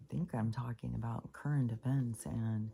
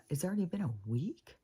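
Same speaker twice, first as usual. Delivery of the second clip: incredulous question; 6 semitones higher than usual. Retold or imitated. Retelling her disbelief to daughter.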